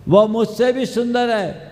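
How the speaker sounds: high, loud retold insistence; emphatic urging. Girl sends suitor to her sister, emphatic.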